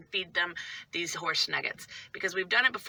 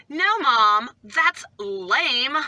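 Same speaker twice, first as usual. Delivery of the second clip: exaggerated kid voice, higher and louder; loud, higher mock kid protest; Christella; 5 semitones higher, 7 dB louder, much slower than usual. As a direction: imitate a scornful kid objecting to mom. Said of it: "it might have been Christella".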